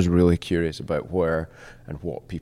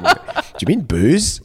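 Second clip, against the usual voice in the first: mock confused question; 10 semitones higher, 8 dB louder, much slower than usual. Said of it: "It's like".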